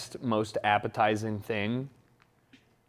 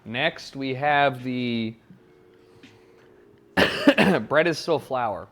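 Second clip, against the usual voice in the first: normal talking voice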